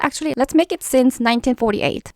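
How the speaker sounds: normal talking voice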